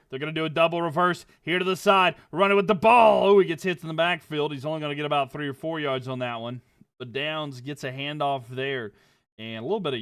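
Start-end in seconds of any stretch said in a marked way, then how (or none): 0.1-4.5 fast, then very loud running call; excited play call. Acted. Calling the double reverse for viewers, building loud.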